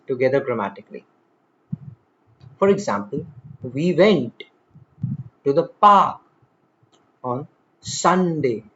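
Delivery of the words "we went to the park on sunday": "went", "park", "Sunday" punched, each followed by a pause; stress on content words. Shown as a demonstration. Teacher demonstrating English rhythm, content words stressed. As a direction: glide over "to the" and "on", land on "park". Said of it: "Notice how we glide over".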